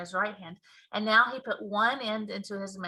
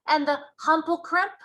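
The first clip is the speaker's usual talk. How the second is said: BFG giant voice; 7 semitones higher, 6 dB louder than usual. Acted. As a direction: boom out the giant's mangled grammar.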